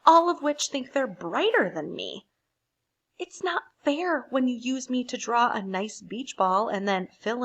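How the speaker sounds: lightly voiced grumbling crayon demanding fairness.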